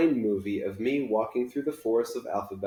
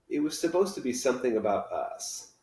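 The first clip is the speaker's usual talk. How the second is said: ordinary delivery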